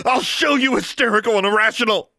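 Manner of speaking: high, loud shrieked threat; screamed. A narrator's reading. Daughter about to smash the locket, screaming. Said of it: "she screamed".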